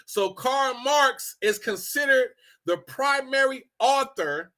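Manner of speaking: ordinary delivery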